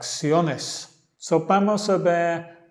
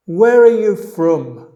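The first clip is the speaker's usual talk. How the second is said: model line; 4 semitones higher, 10 dB louder than usual. Shown as a demonstration. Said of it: "repite los dos lados después a mí".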